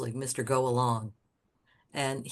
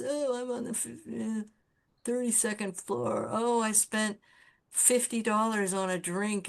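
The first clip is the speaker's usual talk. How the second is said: high, affected mimicry of showy sellouts; mock their boasting in a put-on high voice; mocking braggart; 7 semitones higher than usual.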